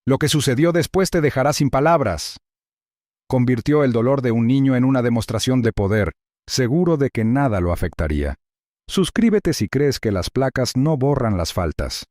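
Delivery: punchy teaser hook, slowing on the call to subscribe; clickbait narrator. A narrator's reading. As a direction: sell the hook like a viral video intro. Video hook before the story. (narrador de vídeo viral)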